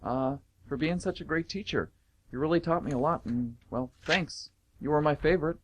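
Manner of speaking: a lightly voiced shy student, halting; shy grateful student. Student thanking old teacher, shy and hesitant. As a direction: thank him haltingly, a bit nervous.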